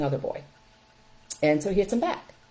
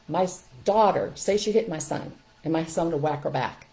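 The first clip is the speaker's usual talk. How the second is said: usual speaking voice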